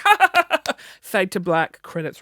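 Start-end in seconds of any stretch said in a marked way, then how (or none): 0.0-0.7 high, loud forced "ha ha"; a hollow polite laugh; fake laugh. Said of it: "I'm like".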